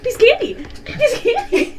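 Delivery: high laughing chant. Real, not acted. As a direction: sing it out giggling. Repeated joke, laughing.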